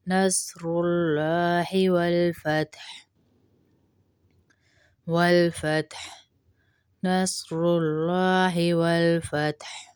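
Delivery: والفتح ending with a clear ح breath, ت unshaken; slow والفتح with audible ح; clear final ha. Shown as a demonstration. Teacher shows the ح sound at the end of والفتح. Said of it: "ہا کی آواز آئے گی".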